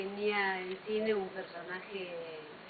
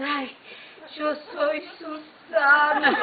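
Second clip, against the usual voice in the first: high, much louder, slow gushing fan voice; emotional fan; 7 semitones higher, 13 dB louder, much slower than usual. Retold or imitated. Fan hugging the actress, gushing in a loud high voice. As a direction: gush like a tearful fan hugging her. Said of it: "gente que me abrazaba y lloraba".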